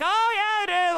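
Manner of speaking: put-on light phone pleasantry; breezy small talk. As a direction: chirp it back casually. Agent's small talk reply.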